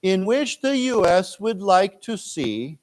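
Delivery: slow read-aloud. Shown as a demonstration. Teacher reads line for students to repeat, slow. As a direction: read it slowly for the class to echo. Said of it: "let's read this".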